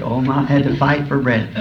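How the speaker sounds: normal talking voice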